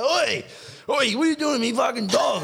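high, loud shout of an angry dog owner; angry owner yelling. Retold or imitated. Dog owner yelling at the stranger patting his dog, loud angry shout. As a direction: bellow it across the fence at a stranger.

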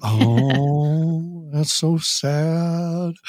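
slow, sing-song mock sympathy to a child; exaggerated slow baby-talk sympathy; toddler voice. Shown as a demonstration. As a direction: drag it out like talking to a toddler. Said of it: "use the toddler voice".

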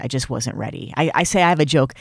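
ordinary talk

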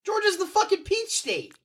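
high, slow, outraged disbelief; indignant outburst. Real, not acted. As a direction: protest it in shock. Outraged at wrong quiz answer.

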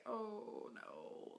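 whispered panic; panicked whisper. Retold or imitated. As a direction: breathe out a worried oh no. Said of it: "I was like".

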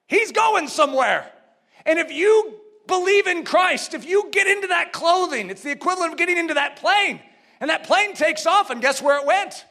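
high-pitched, driving preacher's voice; forceful preaching. Real, not acted. Preacher urging trust through a plane analogy, forceful preaching. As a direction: urge them with rising conviction.

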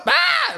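sudden high yelp; kid's yelp. Retold or imitated. Son slipping in the kitchen, high yelp. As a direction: shriek like a toddler slipping. Kid slips and cries out.

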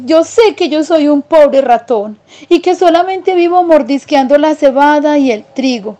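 high little mouse voice, firm no; country mouse refusing. Acted. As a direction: turn down the city life, plainly decided. (ratón campesino rechazando la vida de ciudad, voz aguda)

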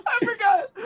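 high squeal through laughter; cracking up mid-word; laughing. Real, not acted. Laughing at embarrassing slip.

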